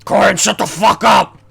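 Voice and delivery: loud, slow, forceful impression; half-managed tough-guy yell, drawn out. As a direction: bellow it slowly in a tired attempt at the voice.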